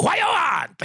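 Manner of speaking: sudden very high, curt demand; acted irritable restaurant server; mock angry Jamaican. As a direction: throw it out high, as if the customer is a nuisance. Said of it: "They know how angry they get".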